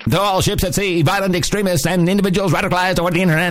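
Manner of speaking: put-on old newsreel. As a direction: broadcast it to all ships at sea. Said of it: "old-time, uh, movie newsreel announcers".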